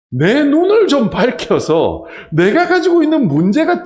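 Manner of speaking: loud, high pulpit preaching; full-force preaching. Acted. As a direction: drive it home to the congregation.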